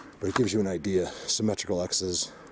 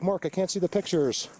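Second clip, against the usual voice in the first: whiny complainer; 9 semitones higher than usual. Retold or imitated. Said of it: "other people that are having trouble".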